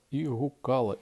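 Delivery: ordinary delivery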